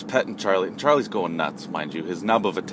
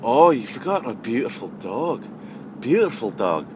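put-on emphatic European accent; 3 semitones higher than usual. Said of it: "like, emphatic".

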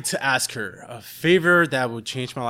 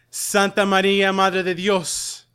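mother's fervent prayer; 5 semitones higher, 4 dB louder than usual. Retold or imitated. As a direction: chant the Hail Mary, rising to fervor. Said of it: "rehearsing the Hail Mary in Spanish".

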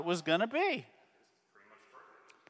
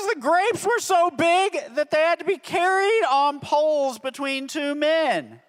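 amazed; 9 semitones higher, 4 dB louder than usual. Real, not acted. Marvels at huge grapes.

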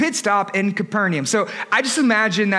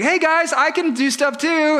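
higher, eager little-guy voice; lightly voiced Bartholomew, higher and hopeful; 8 semitones higher than usual. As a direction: pipe up like the overlooked kid wanting a turn. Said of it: "Bartholomew's like".

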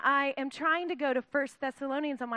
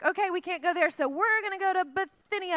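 upbeat Paul; 6 semitones higher, 4 dB louder than usual. Retold or imitated. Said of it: "He's like".

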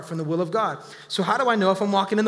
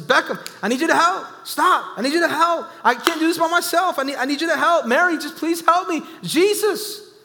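high, loud, exasperated pleading; a frustrated sister calls on Jesus for help, loud and high; mock yelling; 7 semitones higher, 5 dB louder than usual. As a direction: yell for help like a fed-up sibling. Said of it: "He yells at his little brother".

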